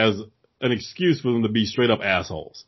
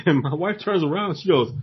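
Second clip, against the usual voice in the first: ordinary delivery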